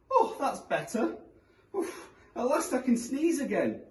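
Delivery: put-on high, relieved old woman; relieved old lady. A narrator's reading. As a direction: sigh it out like a granny.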